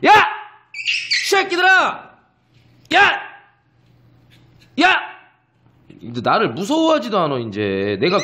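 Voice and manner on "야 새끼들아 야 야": high full-throated yell; mock shout at puppies. Owner yelling at the puppies to show off, big mock shout. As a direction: yell to scare the puppies into line. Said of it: "오빠 소리 한 번만 지를게".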